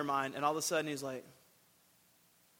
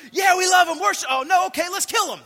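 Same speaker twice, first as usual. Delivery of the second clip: much higher and louder, a hammed-up mob yelling; fickle mob shout; 12 semitones higher, 13 dB louder than usual. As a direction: shout it like a crowd flipping from praise to murder. Fickle crowd turning on Paul.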